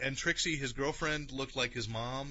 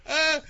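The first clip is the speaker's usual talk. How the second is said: high scream; 10 dB louder than usual. Retold or imitated. Retold scream in sketch.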